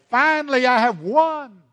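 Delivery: high, loud triumphant devil; Satan proclaiming victory over God, loud and gloating. Acted. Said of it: "Satan proclaims".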